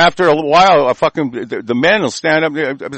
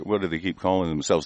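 ordinary talk, nothing marked

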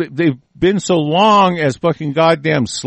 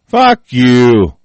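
loud snapped curse; angry outburst; 6 dB louder than usual. Real, not acted. Ranter telling the experts off, loud and angry. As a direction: throw it right at them. Defying the jargon users.

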